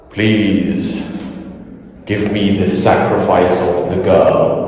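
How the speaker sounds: low, slow booming dragon. Pretend. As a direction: rumble it out like an ancient dragon guarding its lair. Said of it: "They should hire me to do this".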